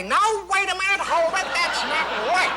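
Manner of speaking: the director stops the take, infected by the voice; director in Smart voice. Retold or imitated. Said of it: "everybody was talking like that".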